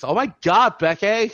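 put-on high, loud valley girl squeal; cheerleader. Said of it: "the high school cheerleader voice".

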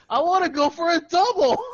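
high, laughing comic whine; mock frustrated wail. Man complaining in baseball innuendo, mock frustrated wail. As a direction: wail it loudly through laughter.

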